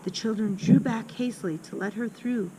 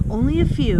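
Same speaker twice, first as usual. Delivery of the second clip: higher and louder, a grim joke from a schoolboy; boy Horatius, offhand; 5 semitones higher, 9 dB louder than usual. Acted. Older boy answering the little girl, higher and louder. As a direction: toss it off darkly.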